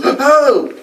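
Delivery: sudden high startled "Oh!"; startled cry. Retold or imitated. Investigator in the hallway startled by the ghost girl, yelping. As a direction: jump as if something touched you. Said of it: "he goes".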